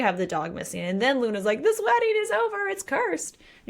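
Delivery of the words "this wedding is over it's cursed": much higher, hammed-up dramatic wail; hammed-up despairing bride, pitched way up; mock despair. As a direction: play the bride wailing her doom, over the top. Voicing Luna declaring wedding cursed.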